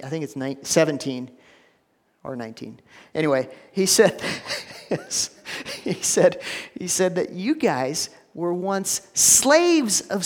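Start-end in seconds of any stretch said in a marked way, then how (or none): 4.2-5.8 short unvoiced laugh; breathy laugh. Real, not acted. Preacher laughing at his verse mix-up. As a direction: chuckle at yourself.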